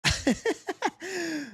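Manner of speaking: sudden high laugh; short laugh. Real, not acted. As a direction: burst out laughing. Laugh before next fight.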